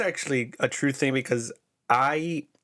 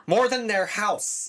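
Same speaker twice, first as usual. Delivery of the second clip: excited; 8 semitones higher, slower than usual. Real, not acted. Heated Super Bowl argument.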